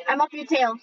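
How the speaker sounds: ordinary talk, nothing marked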